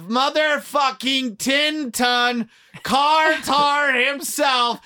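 slow, shouted sponsor hype; hype announcer. Acted. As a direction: boom it out like a ring announcer.

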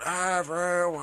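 high, drawn-out mock scream, held back in volume; imitated scream. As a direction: stretch it into a ridiculous stifled yell. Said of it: "he just screamed".

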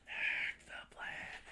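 unvoiced slow whisper. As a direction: whisper the slogan. Whispered chant.

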